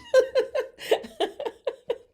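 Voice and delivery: loud high cackle; high burst of laughter. Real, not acted. Big laugh after joke.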